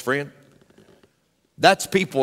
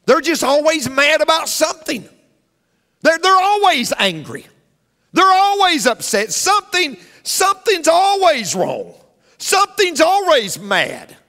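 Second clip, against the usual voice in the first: much higher, louder, pounding out each phrase; preaching at full force; 11 semitones higher, 4 dB louder than usual. Acted. Pastor warns the congregation about angry people, preaching at full force. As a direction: drive it home like a fiery Baptist sermon.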